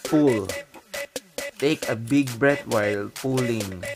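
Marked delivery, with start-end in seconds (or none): none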